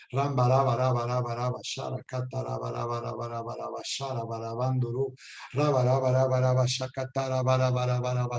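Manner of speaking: low monotone stream of repeated syllables; droning, chant-like glossolalia; speaking in tongues. Real, not acted. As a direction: pray in tongues, rolling syllables on one low pitch. Orar conmigo en estas lenguas.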